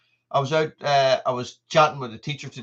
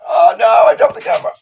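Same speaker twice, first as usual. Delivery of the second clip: loud, high cry of dismay; loud high yelp of alarm; alarmed outburst; 9 semitones higher, 13 dB louder than usual. Real, not acted. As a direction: blurt it out as the camera falls. Dropped the camera.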